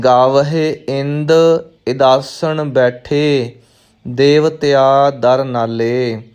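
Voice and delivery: slow recital, ਇੰਦ and ਇਦਾਸਣ kept apart; careful separated reading. Shown as a demonstration. Teacher modelling for learners, slow ਇੰਦ ਇਦਾਸਣ in two words. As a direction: recite slowly, pausing between ਇੰਦ and ਇਦਾਸਣ. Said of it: "ਧਿਆਨ ਰੱਖਣਾ ਹੈ".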